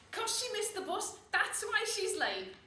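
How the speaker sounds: high, loud, drawn-out heckle; cheeky loud pupil calling out; shout. Acted. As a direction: shout it slowly across the classroom. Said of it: "So I shout".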